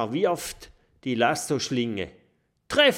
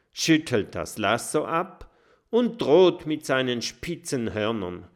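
usual speaking voice

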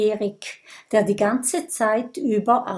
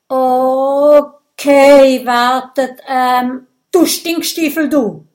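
loud, high string of shouted insults; shouting insults; 4 semitones higher, 10 dB louder, slower than usual. Acted. Brodier shouting insults at Gudröd, loud. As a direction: hurl insults while scrambling for words. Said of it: "ruft Brodier".

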